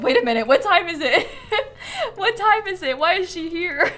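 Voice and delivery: confused surprise. Retold or imitated. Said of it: "you're like".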